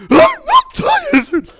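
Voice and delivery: put-on very high, loud whiny child voice; daughter's protest. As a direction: mimic the cranky daughter. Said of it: "I say things like".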